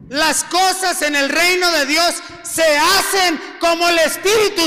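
loud fervent preaching; predicando a gritos. Acted. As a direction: shout it to the church, full conviction. Preaching climax, shouting. (predicación a gritos)

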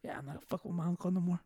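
low, soft, quick grumble; imitating a chastened kid, hushed and hurried; cowed nephew. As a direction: back down under your breath. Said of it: "he looked at me like".

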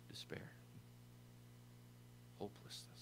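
whispered, weighty single words; solemn near-whisper; hushed. Real, not acted. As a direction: drop to a breath for the grim words. Naming the devil's aim solemnly.

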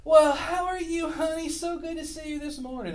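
put-on high, syrupy little old lady voice; sweet old lady greeting. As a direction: gush like a sweet church greeter. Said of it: "she opens up that door".

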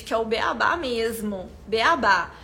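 normal talking voice

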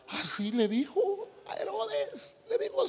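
riendo muy agudo, entre carcajadas; laughing, high. Real, not acted. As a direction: dilo casi llorando de la risa. Se ríe a carcajadas. (riéndose sin poder parar, voz muy aguda)

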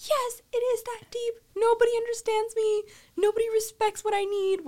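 high-pitched mock tantrum; melodramatic wail. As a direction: wail like a misunderstood, sobbing kid. Her crying outburst at mom, acted.

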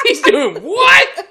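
high, slow, drawn-out he's doing what; stunned disbelief. Retold or imitated. Adult discovering the boy and teacher, slow stunned question. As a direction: stretch out the shock.

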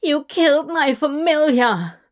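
loud, upset, accusing; tearful angry accusation. A narrator's reading. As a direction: shout it through tears. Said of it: "The woman scowled, tears running".

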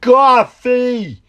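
high, drawn-out imitated yell; loud put-on shout, far above normal pitch. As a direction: holler it like an excited moviegoer. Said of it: "And then he go".

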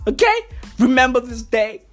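ordinary delivery

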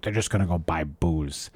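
dismissive put-on grumble; mocking stingy people. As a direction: sneer at the cheapskates' excuse. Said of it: "they say things like".

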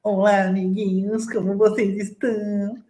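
put-on high, sugary kids' show host voice; sweet, chirpy greeting to little fans; cartoon princess. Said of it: "vai ter que falar bem assim".

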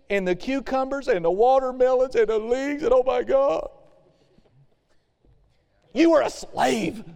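pine for Egypt's food; whining Israelites. Retold or imitated. Preacher voices Israelites longing for Egypt's food, high and whiny.

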